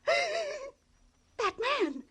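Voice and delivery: high, rising hysterical cries; on the brink of hysteria. Acted. Widow breaks down over body.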